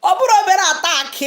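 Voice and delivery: high, laughing outburst; real laughing. Real, not acted. Live host reacting to the family attack story, laughing. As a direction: squeal the words out through laughter. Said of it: "laughing at me".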